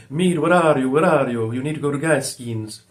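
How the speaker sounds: put-on a loud, half-hearted Greek accent, barking orders; imitating a rude Greek boss in a rough Greek accent; attempted Greek accent. Greek florist boss yelling at his delivery boy, mock Greek accent. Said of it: "I can't do a Greek accent".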